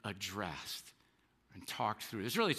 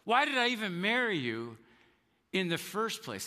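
higher, exasperated mock rant; a touch of a resentful spouse, pitched higher; bitter mock outburst; 5 semitones higher than usual. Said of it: "you're going".

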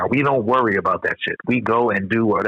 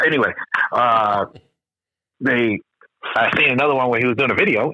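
normal talking voice

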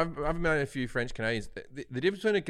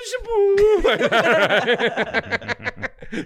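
1 high mock laugh; 8 semitones higher, 8 dB louder than usual. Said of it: "they hear this".

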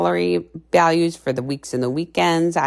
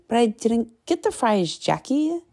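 client quoted; 8 semitones higher than usual. Retold or imitated. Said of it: "client protests to coach".

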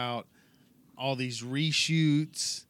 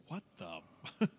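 mock shocked; 3 semitones higher, 10 dB quieter than usual. Imagined Vader's shocked reaction.

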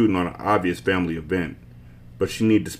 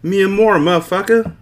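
much higher, louder frustrated shout; angry yell; 12 semitones higher, 5 dB louder than usual. A narrator's reading. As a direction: spit it out furiously. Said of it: "she spat out her frustration".

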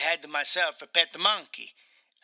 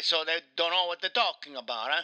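put-on Italian accent, aggrieved game character; Mario with Italian accent; faster than usual. Mario complains to his interviewer, in a thick Italian accent. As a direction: play an indignant Italian Mario.

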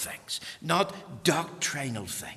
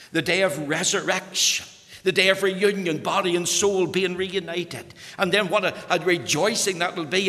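rising, louder, higher preaching climax; full-force preaching; 7 semitones higher, 7 dB louder than usual. Acted. Preacher at a funeral proclaiming reunion day, at full voice. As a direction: proclaim the resurrection day with mounting fervour.

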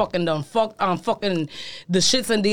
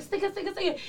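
soft urging; 6 semitones higher, 11 dB quieter than usual. Retold or imitated. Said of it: "And I'm like".

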